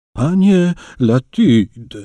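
put-on the old innkeeper yells at Latit in fury, slow and loud; angry yell. A narrator's reading. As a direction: bellow his name at the cheat. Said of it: "wrzasnął stary".

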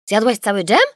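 loud aggrieved question; groaning complaint. A narrator's reading. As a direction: moan at the loss of the jam. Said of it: "jęknął tata". (tata jęczy z żalem)

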